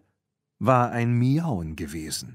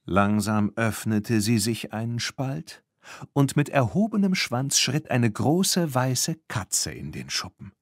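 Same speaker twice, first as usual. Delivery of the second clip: usual speaking voice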